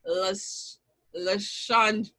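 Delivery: usual speaking voice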